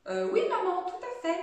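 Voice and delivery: voix plus haute et plus forte, légèrement jouée; réponse d'enfant jouée. A narrator's reading. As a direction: answer Mum in a lightly acted child's voice. Enfant qui répond à sa mère. (réponse d'enfant à maman, un peu jouée)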